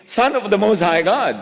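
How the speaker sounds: a hint of a cowering evil spirit. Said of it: "Sabi namang evil spirit".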